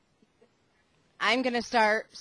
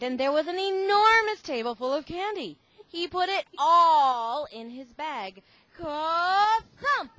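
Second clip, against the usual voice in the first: higher, louder, wonder-filled telling; excited; 7 semitones higher, 8 dB louder than usual. A narrator's reading. Reading to the kids about the candy table again, excited. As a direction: marvel at all that candy.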